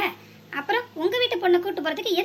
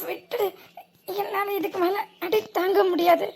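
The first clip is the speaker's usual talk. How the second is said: pleading whimper; 3 semitones higher than usual. Acted. Beaten thug begging to stop.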